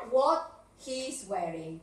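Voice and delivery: slow what he's wearing, what stressed; corrected version. Shown as a demonstration. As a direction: model the correct form slowly. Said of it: "Qué es lo que está de más aquí".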